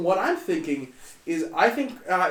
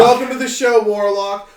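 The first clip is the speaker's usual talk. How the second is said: loud host welcome; 4 semitones higher, 10 dB louder than usual. Pretend. Hosting welcome to guest.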